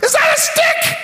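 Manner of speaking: very high squeaky mutant asking. Acted. Preacher mocks the giant with a squeaky monster voice. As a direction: pitch it way up like a silly monster. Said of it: "what mutants sound like".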